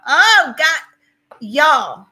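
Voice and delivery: loud, high cry of real dismay; upset outcry. Real, not acted. Streamer reading of a viewer's loss, distressed outcry. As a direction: overcome by sudden bad news.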